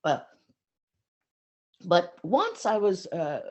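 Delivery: ordinary talk, nothing marked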